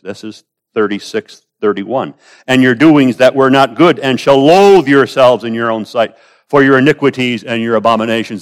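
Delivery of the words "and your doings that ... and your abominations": raised, loud pulpit reading; forceful scripture recitation; declaimed scripture. Acted. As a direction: proclaim the verse as a solemn charge.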